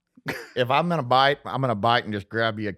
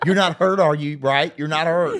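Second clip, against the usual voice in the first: higher, louder pleading through laughter; mock frantic reassurance; 5 semitones higher, 4 dB louder than usual. Retelling how the driver talked to the kid after the wreck, mock frantic. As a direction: press anxiously for a yes, hammed up.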